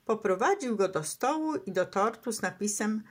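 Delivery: ordinary delivery, nothing marked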